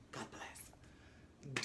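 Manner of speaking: a tiny, whispered blessing. Real, not acted. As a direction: let it out under your breath. Whispered aside after sad moment.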